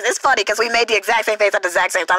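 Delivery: normal talking voice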